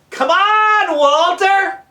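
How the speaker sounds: high, impatient holler; Mario yelling down. Acted. Mario hurrying Walter along, impatient yelling. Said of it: "Mario yells down".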